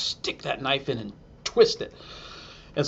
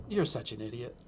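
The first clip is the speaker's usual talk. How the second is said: deadpan put-down; 3 semitones lower, 10 dB quieter than usual. Retold or imitated. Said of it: "and she said".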